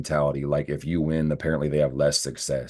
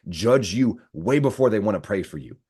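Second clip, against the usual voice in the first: ordinary talk, nothing marked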